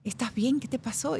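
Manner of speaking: put-on grandma checking on the crying girl; lightly voiced caring grandmother; concerned grandmother. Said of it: "me dice".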